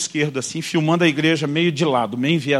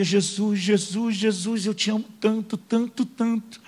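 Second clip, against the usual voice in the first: tearful prayer; 4 semitones higher, 5 dB quieter, slower than usual. Retold or imitated. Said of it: "Ele vinha chorando, quebrantado".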